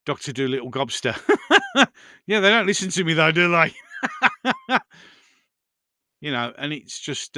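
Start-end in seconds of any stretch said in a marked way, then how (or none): 1.2-1.9 quick high burst of laughter; real chuckle; short laugh. Real, not acted. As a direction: laugh at the joke. Laughing at chat joke.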